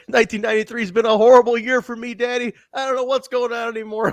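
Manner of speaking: high-pitched, hurried mock lament; wrestler promo impression. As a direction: imitate a distraught wrestler, amused underneath. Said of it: "I love the promo last week".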